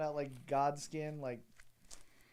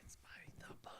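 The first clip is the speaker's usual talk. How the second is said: soft unvoiced whisper; 16 dB quieter, much faster than usual. Real, not acted. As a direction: say it in a hushed whisper. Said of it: "why we're whispering".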